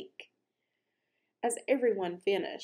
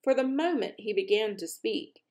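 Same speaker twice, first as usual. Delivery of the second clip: usual speaking voice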